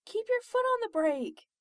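panicked high, loud yell; shrieking. A narrator's reading. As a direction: shriek an urgent order at the driver. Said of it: "Christina shrieked".

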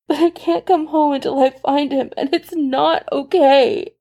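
high, breaking, pleading through tears; tearful plea. A narrator's reading. Steve tearfully confessing to Tony he must go. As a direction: let the voice crack as he begs. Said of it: "helpless to halt the tears".

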